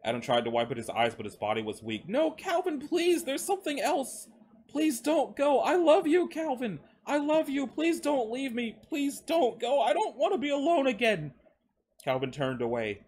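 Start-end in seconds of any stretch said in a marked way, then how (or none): 2.1-11.3 high, tearful desperation; distraught high-pitched wail; pleading and begging. A narrator's reading. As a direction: beg him to stay, voice breaking. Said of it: "who was pleading and begging on the ground".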